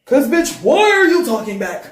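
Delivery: loud, high freaked-out demand; freaking out. Acted. Narrator confronting her talking reflection, freaking out. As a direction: snap loudly at the thing talking back. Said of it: "Now I'm freaking the fuck out".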